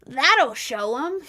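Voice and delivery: mock the trolls smugly. Mocking the trolls' thinking.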